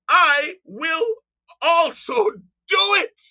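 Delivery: loud, high, slow shouted decree; Creator speaking. Retold or imitated. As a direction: shout each word as a divine promise. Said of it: "This is the Creator speaking".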